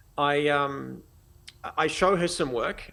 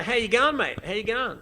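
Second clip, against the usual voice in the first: higher-pitched, laid-on Australian greeting; hammed-up Australian hello; thick Australian accent; 6 semitones higher than usual. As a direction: lay the Aussie accent on thick to charm. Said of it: "I lay the Australian accent thick".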